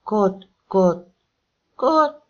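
put-on exhausted boy's high-pitched clucking. A narrator's reading. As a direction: imitate a hen, leaping up on the last "kot". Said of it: "mondta magasabb hangon, az utolsó szótagot megnyújtva".

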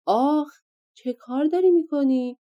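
a sharp, high, loud cry of pain; giant's yelp. Acted. Giant woken by a hair pulled out: loud pained cry. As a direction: yelp then demand an answer. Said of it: "غول داد کشید".

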